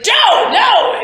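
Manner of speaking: very high, loud protest; alarmed shout. Acted. Pierce yells at Joe after catching someone, high alarmed shout. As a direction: yell it in panicked protest. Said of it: "he's like, he's like".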